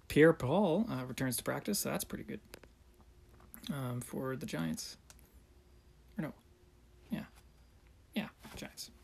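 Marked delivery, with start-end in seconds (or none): 6.2-8.9 whispered. Real, not acted. Unenthused correction.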